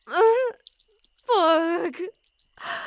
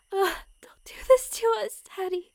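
trembling whisper; 4 semitones higher than usual. Acted. Pleading under her breath.

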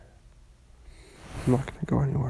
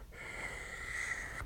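long, soft shh; quiet, drawn-out shushing; soothing shush; 16 dB quieter than usual. Acted. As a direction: hush them gently. Hushing a crying partner.

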